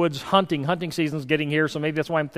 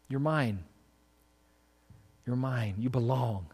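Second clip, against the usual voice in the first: much softer and lower, almost breathed; tender, hushed; 5 semitones lower, 9 dB quieter than usual. Acted. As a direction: speak it like a loving promise in the ear. Tender whisper of God's words.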